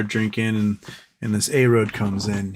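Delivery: ordinary talk, nothing marked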